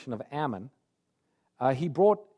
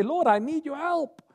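much higher, urgent cry for help; desperate plea; 11 semitones higher than usual. Acted. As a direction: cry it up high like you're sinking. Believer pleading with God.

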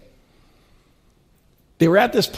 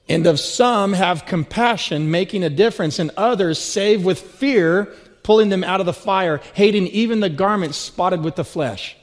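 scripture reading; 3 semitones higher, 4 dB louder than usual. Acted. Reads scripture aloud.

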